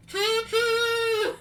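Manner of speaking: high, loud choo choo cheer; train call cheer. Real, not acted.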